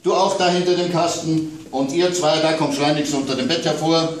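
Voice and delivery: father shooing monsters toward the balcony door; bossy shouting. A narrator's reading. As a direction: herd the monsters out briskly.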